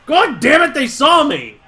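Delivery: high, loud frustrated outburst; exasperated yell. Real, not acted. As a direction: curse loudly at being caught. Spotted by guards in game.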